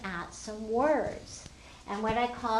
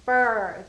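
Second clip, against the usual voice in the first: Fur said loud and clear; stressed word; 9 dB louder than usual. Shown as a demonstration. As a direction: punch the word Fur for kids to hear. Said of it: "do you hear an R sound there".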